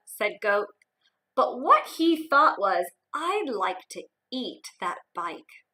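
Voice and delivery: put-on lively read-aloud for young kids; expressive picture-book narration; storybook reading.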